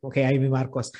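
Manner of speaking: ordinary talk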